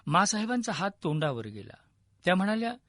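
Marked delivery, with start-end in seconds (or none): none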